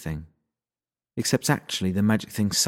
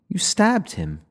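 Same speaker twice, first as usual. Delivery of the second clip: shocked; 9 semitones higher, slower than usual. Acted. Girl shocked after stabbing.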